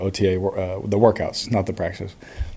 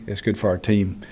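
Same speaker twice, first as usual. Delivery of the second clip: ordinary talk, nothing marked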